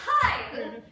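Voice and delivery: sudden very loud warning yell. Retold or imitated. Teen warning hidden boyfriend that mom is home, yelling. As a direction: blurt it out at full volume. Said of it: "And I yelled".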